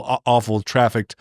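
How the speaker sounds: ordinary delivery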